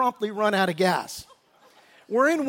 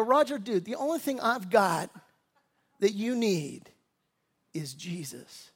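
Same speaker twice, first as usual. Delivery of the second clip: gentle sincere answer; 3 semitones lower, 6 dB quieter than usual. Retold or imitated. Pastor's gentle answer to Roger.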